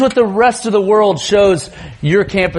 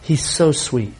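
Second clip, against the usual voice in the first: normal talking voice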